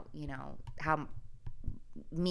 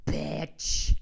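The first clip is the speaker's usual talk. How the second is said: louder, punched sign-off insult; punchy swear; 8 dB louder than usual. Pretend. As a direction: spit out the last word for the joke. Punchline after holiday greeting.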